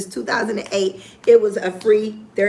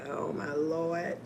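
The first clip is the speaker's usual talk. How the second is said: much softer, slow private thought; inner thought; 4 semitones lower, 13 dB quieter, slower than usual. Retold or imitated. Her slow, much softer private reaction to being recognized. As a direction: say it under your breath, slowly. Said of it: "I'm thinking".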